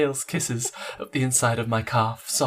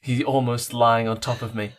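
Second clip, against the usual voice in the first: ordinary talk, nothing marked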